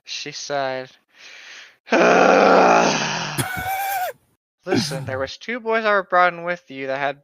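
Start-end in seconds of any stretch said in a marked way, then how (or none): 1.9-4.1 loud drawn-out sigh of annoyance; huge exasperated sigh. Acted. Fed-up nurse sighing loudly at the patient. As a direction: let out a big fed-up sigh. Nurse sighs before answering.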